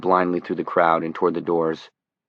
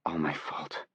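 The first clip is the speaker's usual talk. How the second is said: choked up; 2 semitones lower, 13 dB quieter than usual. Acted. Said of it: "throat close and tight with shame, with tears".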